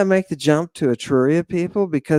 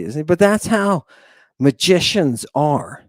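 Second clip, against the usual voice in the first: ordinary delivery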